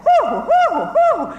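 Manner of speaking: string of high, loud whoops; crowd whoops. Retold or imitated. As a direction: echo the crowd's joyful calls back and forth. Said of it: "So you get".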